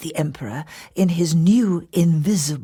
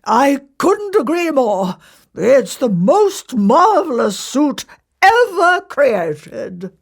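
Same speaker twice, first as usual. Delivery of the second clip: raised, slow, grand emperor; pompous emperor delight; Emperor's voice; 6 semitones higher, 3 dB louder, slower than usual. Acted. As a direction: proclaim it like a vain ruler.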